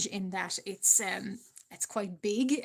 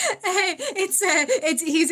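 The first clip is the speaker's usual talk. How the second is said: louder, higher, talking through laughter; real laugh spilling into the words; 8 semitones higher, 6 dB louder than usual. Real, not acted. Streamer teasing a viewer about his accent, laughing.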